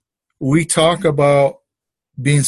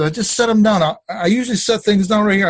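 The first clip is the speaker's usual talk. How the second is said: higher-pitched, mildly exasperated protest; worked up; 7 semitones higher than usual. Retold or imitated. Husband insisting to wife he set them down, worked up. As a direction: replay getting worked up, voice pitched up. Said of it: "I'm getting kinda worked up".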